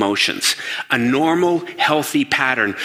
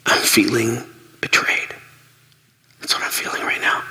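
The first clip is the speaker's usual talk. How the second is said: whispered self-check. Pretend. Person pausing to name their feelings, whispered to themselves. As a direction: whisper it to yourself, searching.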